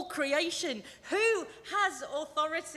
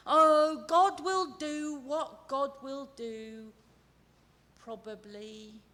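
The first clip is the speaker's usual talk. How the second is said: fatalism; 4 dB louder, slower than usual. Retold or imitated. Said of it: "That's fatalism".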